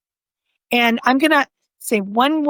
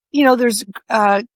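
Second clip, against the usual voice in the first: ordinary delivery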